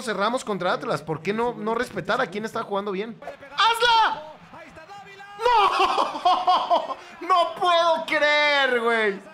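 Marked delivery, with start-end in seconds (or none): 3.5-4.2 high, loud urging yell; urgent shout. Real, not acted. Urging player during attack. 5.4-9.2 high, loud, drawn-out cry; disbelieving wail. Real, not acted. Reacts to a missed chance.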